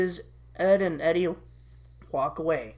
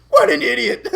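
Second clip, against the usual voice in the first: loud, higher mocking devil voice; jeering shoulder devil; 5 semitones higher, 9 dB louder than usual. Devil mocking Eddie, loud jeer. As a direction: jeer like a gleeful little devil. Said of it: "Devil Eddie".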